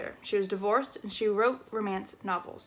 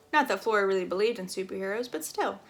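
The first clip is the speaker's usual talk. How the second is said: ordinary delivery, nothing marked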